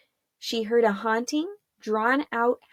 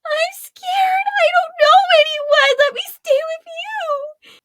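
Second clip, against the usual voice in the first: put-on very high, loud crying child voice; bawling; 6 dB louder than usual. Frightened little girl begging Arella to keep her, bawling high. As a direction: wail like a scared little girl. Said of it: "she bawled".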